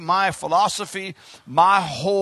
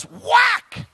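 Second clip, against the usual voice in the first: sudden high exclaimed whack; mimicked smack; 13 semitones higher than usual. Preacher imitating daddy's whipping, sudden high cry. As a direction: crack it out high, like a blow landing. Imitates the blow that came next.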